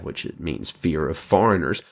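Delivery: normal talking voice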